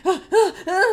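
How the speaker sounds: high, loud acted panting; panicked gasping. Kobold sweating in handcuffs, panicked gasps. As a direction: gasp in a hammed-up panic. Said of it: "He's, like, sweating".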